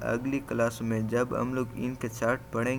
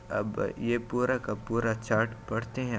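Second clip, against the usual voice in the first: normal talking voice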